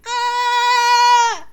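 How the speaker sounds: full loud high terrified scream; horrifying scream. Acted. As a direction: let out real terror. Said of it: "Much better".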